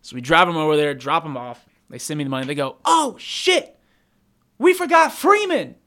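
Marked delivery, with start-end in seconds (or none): 2.8-5.7 high, loud oh-no; surprised realization. Retold or imitated. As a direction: blurt it out in sudden panic. Passengers realizing they forgot friend.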